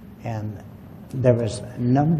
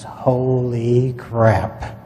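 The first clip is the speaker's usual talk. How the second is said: slow, drawn-out holy crap; stunned awe; 6 dB louder, much slower than usual. Retold or imitated. Dad seeing the spacecraft, drawn-out stunned awe. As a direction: let it stretch out in amazement. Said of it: "and he goes".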